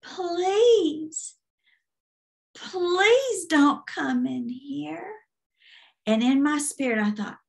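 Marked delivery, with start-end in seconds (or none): 0.0-5.1 high, slow creaky plea; begging squeaky demon. Retold or imitated. Demon begging her before she can respond, high creaky wail. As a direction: drag out the begging like a squeaking hinge. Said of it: "He begged me".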